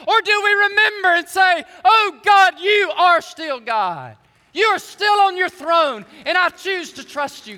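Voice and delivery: loud, high shout of faith; shouted prayer. Acted. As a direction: shout it to God with everything. Shouted preaching climax.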